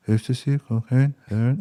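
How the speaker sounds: mumbled, blurred mock sales offer; unintelligible street dealer. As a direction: imitate a dealer slurring the list of drugs. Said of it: "I can't understand what you're saying".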